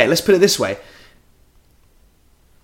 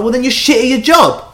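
higher, exasperated mock outburst; angry retort; 10 semitones higher, 4 dB louder than usual. Guest tells the hypnotist he's useless, angry retort. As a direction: retell it as an indignant rising yell. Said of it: "you start to get really angry".

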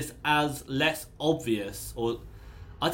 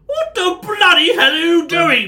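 angry shout; 13 dB louder than usual. Acted. Guard shouts at the dwarf hanging on the shield, furious yell. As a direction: yell it high and loud in outrage.

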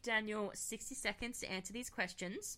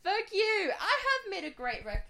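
high, loud laughing protest; mock outrage; 10 semitones higher, 6 dB louder than usual. Real, not acted. Host hits back at boyfriend's jab, laughing outrage. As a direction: protest loudly through laughter.

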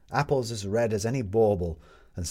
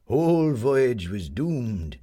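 put-on gruff old fisherman; 3 semitones higher, 3 dB louder, much slower than usual. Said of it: "said the old man".

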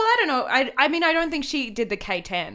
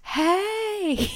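high, hammed-up Southern greeting; Southern accent; 9 semitones higher than usual. Podcaster showing off the locked-in character, Southern accent. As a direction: put on a comic Southern twang. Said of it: "so more southern".

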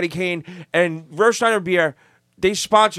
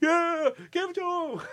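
egging him on; 12 semitones higher, 7 dB quieter than usual. Retold or imitated. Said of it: "And I was like".

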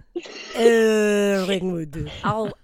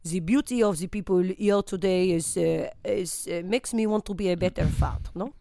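lightly hammed French accent; a comic Frenchman being interviewed; 3 semitones lower than usual. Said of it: "Pierre Jambon".

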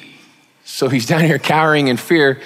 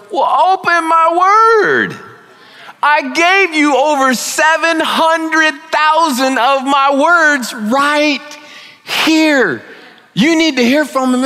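God gently scolding, drawn-out; God's voice; 13 semitones higher, slower than usual. Retold or imitated. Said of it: "and he's going".

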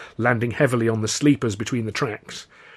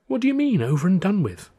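high-pitched, baffled questioning; puzzled; 9 semitones higher than usual. A narrator's reading. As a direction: question it in disbelief, voice jumping up.

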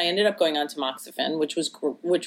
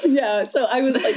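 laughing; 7 semitones higher, 3 dB louder than usual. Real, not acted. Laughing while telling story.

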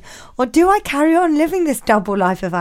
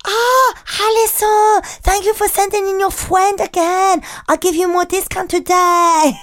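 higher, hammed-up Vietnamese accent; imitating a Vietnamese nail lady with a higher voice; 9 semitones higher, 4 dB louder than usual. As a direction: put on a cheerful Vietnamese shopkeeper accent. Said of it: "cue [name]'s Vietnamese accent".

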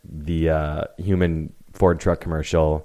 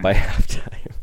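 laughing; 2 semitones higher, slower than usual. Real, not acted. Joke about coach still employed.

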